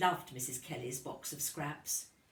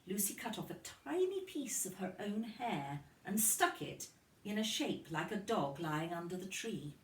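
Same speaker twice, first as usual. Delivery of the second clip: usual speaking voice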